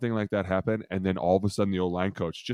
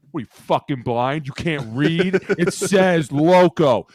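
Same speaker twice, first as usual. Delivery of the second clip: higher, louder, very slow sneer; mock berating coach; 6 semitones higher, 8 dB louder, slower than usual. Defensive coach berating a scout player who misread the card. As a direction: spit it out like a furious defensive coach.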